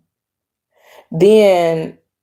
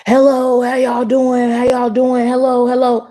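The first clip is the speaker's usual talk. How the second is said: high-pitched mock yell, insistent; imitated yelling; 7 semitones higher than usual. Stranger yells at couple who ignored him, mock yelling. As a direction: mimic someone calling right in their face. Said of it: "yelling at us in our face".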